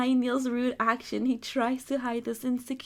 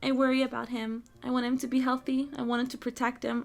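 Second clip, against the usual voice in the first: normal talking voice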